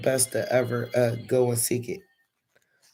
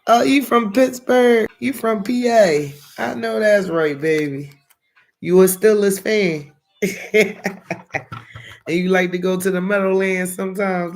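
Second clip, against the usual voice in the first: much higher and louder, delighted chatter; thrilled; 6 semitones higher, 7 dB louder than usual. Real, not acted. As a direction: gush over the viewer's hometown. Thrilled reacting to viewer's hometown.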